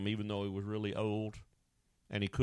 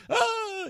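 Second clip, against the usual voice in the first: mock wail; 7 dB louder than usual. Mourners wailing at the funeral.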